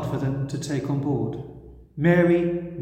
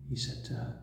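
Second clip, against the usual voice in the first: normal talking voice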